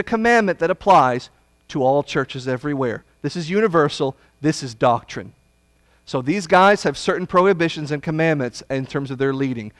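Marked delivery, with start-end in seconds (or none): none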